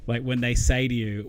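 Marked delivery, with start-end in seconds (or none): none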